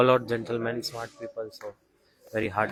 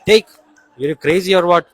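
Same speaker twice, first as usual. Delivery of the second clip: high, loud scolding offer; rough insisting yell; 5 semitones higher, 12 dB louder than usual. Retold or imitated. Brajavasi shouting food at fasting monk, loud. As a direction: yell at him to take it. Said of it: "He said".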